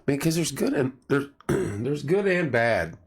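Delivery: hoarse, voice going; straining a tired, hoarse voice. Real, not acted. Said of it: "My voice is going down".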